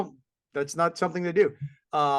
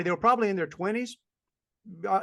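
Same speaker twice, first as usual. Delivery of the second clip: usual speaking voice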